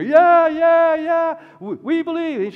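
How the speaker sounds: excited ringleader shouting belief; crowd ringleader cheering. Retold or imitated. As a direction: whip up the crowd like the loudest cheerer. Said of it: "he finds the ringleader".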